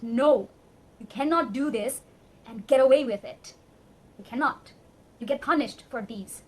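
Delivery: emotional. Real, not acted. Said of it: "I'm very emotional right now".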